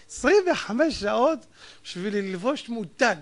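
usual speaking voice

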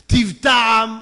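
loud, drawn-out cry of the name; acted outraged intoning; loud lament. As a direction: proclaim it with the last syllable stretched. Shop name cried out, later muttered.

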